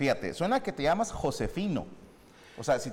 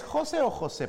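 José said two ways, first-syllable against last-syllable stress; contrasting stress on José; 9 semitones higher than usual. Shown as a demonstration. Host checks the guest's name, contrasting stresses on José. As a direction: offer José both ways, shifting the accented syllable. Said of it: "José con acento en la O".

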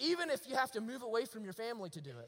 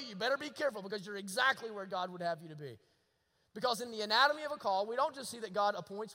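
usual speaking voice

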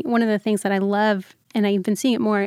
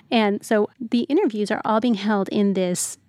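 ordinary delivery

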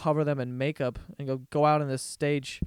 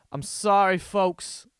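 hammed-up apologetic announcer, breaking off to laugh; loud, drawn-out mock announcement; Steve Harvey; 4 semitones higher, 6 dB louder, slower than usual. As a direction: announce the blunder like an awkward host. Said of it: "then Steve Harvey goes".

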